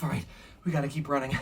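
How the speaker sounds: plain reading voice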